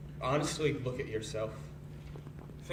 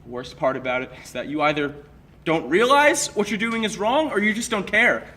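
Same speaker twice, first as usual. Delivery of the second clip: loud, raised-pitch accusation; angry confrontation; 3 semitones higher, 10 dB louder than usual. Acted. Confronting a friend on a path to destruction, angry. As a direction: let the anger burst out, hitting words hard.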